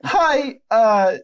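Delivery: high, loud pubescent squeak; squeaky, voice-breaking boy. Acted. Dipper announcing he's going out with Candy, squeaky boy voice. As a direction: play a twelve-year-old boy, pitched right up. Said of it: "squeaky, voice-breaking voice".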